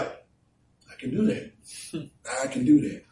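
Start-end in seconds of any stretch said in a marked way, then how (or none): 0.0-1.5 high, slow, breathy "I can do that"; slow breathy boast. Pretend. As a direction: breathe "I can do that" out slowly, as if dreaming of it. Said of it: "we feel like".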